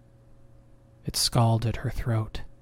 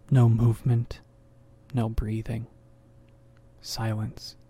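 ordinary delivery, nothing marked

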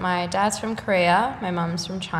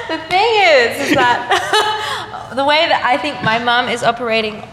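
high, louder words through laughter; laughing; 6 semitones higher, 7 dB louder than usual. Real, not acted. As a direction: keep laughing while explaining. Laughing at the question.

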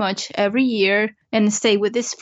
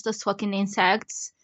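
normal talking voice

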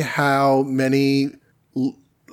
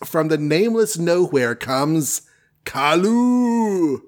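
grand comic-book announcer read; dramatic announcer; 3 semitones higher, slower than usual. Acted. Reading the comic's opening caption, dramatic announcer voice. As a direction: boom it out like an old comic intro.

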